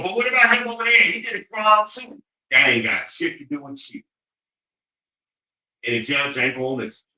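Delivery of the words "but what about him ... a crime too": much higher, louder whiny plea; mocking defendant. A defendant deflecting to the judge, a high whiny mock plea. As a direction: whine like a guilty man pointing at others. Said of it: "and go".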